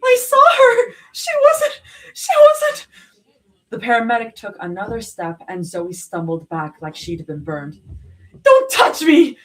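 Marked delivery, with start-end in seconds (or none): 0.0-2.9 very high, loud breaking sob; crying, voice breaking; sobbing. A narrator's reading. Sobbing after girlfriend's stabbing. 8.4-9.4 high, loud snapping order; sharp cracking snap; snapped. A narrator's reading. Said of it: "she snapped, voice cracking".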